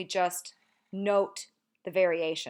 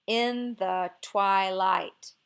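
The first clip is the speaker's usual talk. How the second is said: very slow, louder syllable-by-syllable "in the twilight"; scanning last feet; 5 dB louder, much slower than usual. Shown as a demonstration. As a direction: stretch out "in the TWI-light" beat by beat. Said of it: "if we get to the last two feet".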